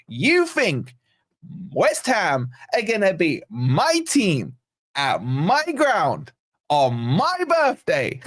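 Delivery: drawn-out, swooping stress on each "my"; mock indignation. Streamer quoting Hooks on his birthday match, mock-indignant stress on "my".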